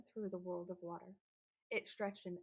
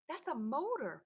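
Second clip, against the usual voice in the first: excited realization; 6 semitones higher, 6 dB louder than usual. A narrator's reading. Frederick recognizes the sound.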